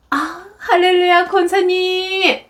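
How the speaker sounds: high, drawn-out churchy phone greeting; imitated two-faced phone cheer; sudden sweet greeting. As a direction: switch to gushing politeness, stretching it out. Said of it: "혼을 내다가 갑자기 전화가 오면".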